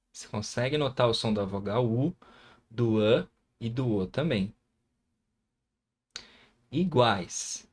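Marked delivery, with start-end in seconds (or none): none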